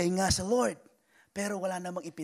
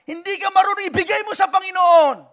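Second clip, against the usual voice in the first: high, loud call to surrender; preaching shout; 9 semitones higher, 13 dB louder than usual. Real, not acted. Pastor urging the flock to give it to God, shouting. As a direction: shout it out from the pulpit.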